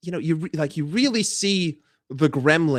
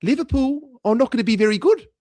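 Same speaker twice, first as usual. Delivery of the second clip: light, high New Zealand accent; New Zealand accent lifted above your own voice; higher-pitched Kiwi accent; 6 semitones higher than usual. Shown as a demonstration. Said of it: "it's, like, a little bit higher pitched".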